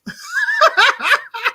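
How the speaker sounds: very high burst of cackling; laughing. Real, not acted. Laughing at hopeful Saints fans, high cackle. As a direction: crack up at your own imitation.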